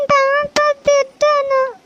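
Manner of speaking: very high, slow tearful wail; crying. Acted. Child in mother's arms wants grandfather to scold her, crying. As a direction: whine out a grievance through tears. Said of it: "எதுக்கு அழுறா?".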